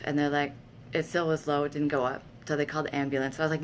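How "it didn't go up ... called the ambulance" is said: ordinary delivery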